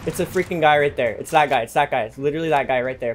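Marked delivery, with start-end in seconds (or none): none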